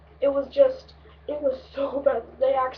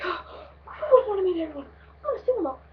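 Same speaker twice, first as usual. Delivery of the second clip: excited gushing; 6 semitones higher than usual. Retold or imitated. Wanting to meet everyone at the concert, high excited gushing. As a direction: act out giddy anticipation, pitched up. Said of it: "we're all excited, we're all happy".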